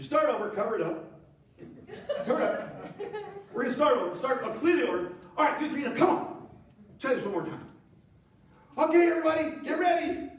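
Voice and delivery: a king scrambling to restart the show; exasperated king. Retold or imitated. King to the three boys and crowd, flustered yelling. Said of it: "he's like".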